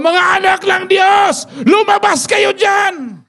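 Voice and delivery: high slow giant's taunting shout; preached giant's bellow, high and slow; Goliath shouting. Retold or imitated. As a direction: call the army out like a giant. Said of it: "At sumigaw".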